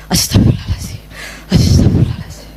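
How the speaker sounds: breathy whispered prayer for calm; whispered istighfar. Retold or imitated. Mother whispering istighfar at the gate before scolding her son. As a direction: whisper it to settle yourself down.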